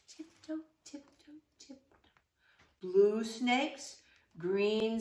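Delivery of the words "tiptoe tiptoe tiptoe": soft tiptoe whisper. Acted. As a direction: breathe the words out softly. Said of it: "If we're very quiet".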